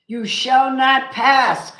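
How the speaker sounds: loud, slow, high declaration; mock commanding shout. A tarot reader acting out a wizard for Leo, commanding shout. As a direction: bellow it like a wizard blocking a bridge. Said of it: "Hold the master wand up and go".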